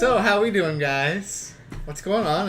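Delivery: put-on drunk friend.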